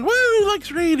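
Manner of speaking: high, loud celebratory cheer; excited whoop. Real, not acted. Streamer welcoming a raid, excited. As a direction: whoop for the arriving crowd.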